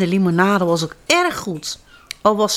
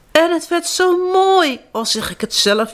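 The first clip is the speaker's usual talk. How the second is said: wailing; 10 semitones higher than usual. A narrator's reading. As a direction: wail it like a disappointed old artist. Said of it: "jamde de Zwifser".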